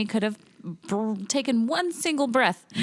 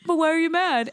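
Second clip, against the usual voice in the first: higher, innocent mock question; mocking Tony; 7 semitones higher than usual. Imitating Tony's clueless question to her, higher mock innocence. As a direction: play dumb in a higher voice. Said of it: "And then you were like".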